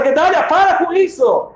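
high, loud, drawn-out stop; group shout. Acted. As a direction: call out to make him quit. Friends all shout at him.

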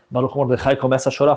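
ordinary delivery, nothing marked

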